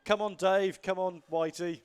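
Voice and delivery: ordinary talk, nothing marked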